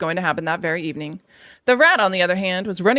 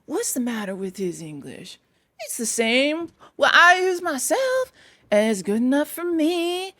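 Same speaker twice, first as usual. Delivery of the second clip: peevish Badger; 7 semitones higher, slower than usual. A narrator's reading. Badger defending Toad's English, peevish. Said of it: "inquired the Badger rather peevishly".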